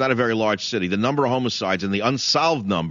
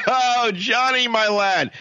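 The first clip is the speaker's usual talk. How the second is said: high, slow laughing fond drawl; 12 semitones higher, much slower than usual. Real, not acted. Host chuckling fondly at the caller's tough tale, laughing high and slow.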